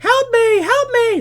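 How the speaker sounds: high, loud cry for help; screaming. Retold or imitated. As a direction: imitate a panicked kid screaming for rescue. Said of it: "That kid's still screaming".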